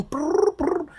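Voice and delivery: high trilled "brr"; imitated phone ring. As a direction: trill it twice like a ringing phone.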